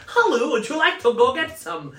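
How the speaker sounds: high, loud, silly Norwegian voice; hammed-up Norwegian pickup line; mock Norwegian accent. Comic mimics hot Viking ruining it by talking, silly Norwegian accent. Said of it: "Norwegians are so hot until we open our mouth".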